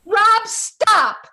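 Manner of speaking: loud high command; shouting stop. Acted. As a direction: yell at him to stop. Mother stops son taking cat.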